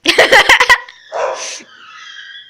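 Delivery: loud high laughter; high burst of laughter. Real, not acted.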